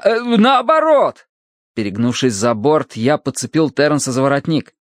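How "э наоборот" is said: high, drawn-out shout; hesitate, then yell the correction. A narrator's reading. Narrator corrects his order to the rower, yelling. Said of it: "завопил я".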